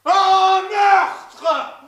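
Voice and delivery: shouted accusation. Acted. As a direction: yell it out in alarm. Accusing friend of murder.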